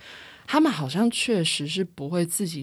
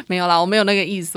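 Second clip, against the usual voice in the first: laughing; 3 semitones higher, 4 dB louder, much faster than usual. Real, not acted. Laughing off own remark.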